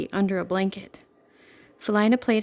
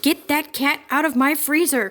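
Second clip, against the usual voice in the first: higher, louder angry manager; angry cry; 6 semitones higher, 3 dB louder than usual. A narrator's reading. Store manager yelling at the intruders, higher and angry. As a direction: bark the order at the cat's owners. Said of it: "cried the manager".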